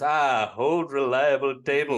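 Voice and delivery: slow, loud, contented cartoon husband; Homer Simpson. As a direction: imitate a fond, relieved sigh of trust, drawn out. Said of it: "lands on the table and goes".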